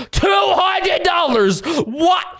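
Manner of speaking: high shocked exclamation; outraged disbelief. Real, not acted. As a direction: blurt it out in disbelief. Outraged at Labubu prices.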